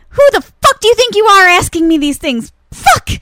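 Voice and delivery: high, loud mock tirade; angry stage monologue. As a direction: act her rant, building to a shouted curse.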